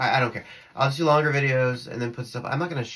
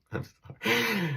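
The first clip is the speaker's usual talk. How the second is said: high, slow laugh breaking into sorry; laughing apology; 9 semitones higher, slower than usual. Real, not acted. Laughing at her own remark.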